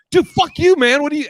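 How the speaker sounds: high, loud mock yell; mock furious yell. Offended comic screaming at heckler, high and loud. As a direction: blow up at the heckler. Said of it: "And he's like".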